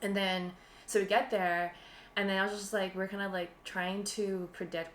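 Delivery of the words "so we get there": normal talking voice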